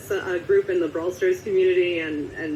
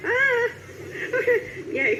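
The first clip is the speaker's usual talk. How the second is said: laughing cry; slower than usual. Real, not acted.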